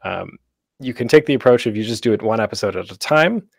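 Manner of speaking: ordinary delivery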